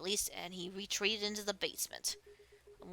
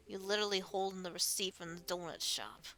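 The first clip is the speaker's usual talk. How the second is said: normal talking voice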